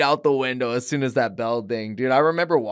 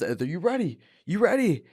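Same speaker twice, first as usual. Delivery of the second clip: referee asking; 6 semitones higher than usual. Retold or imitated. Ref asks before fight starts.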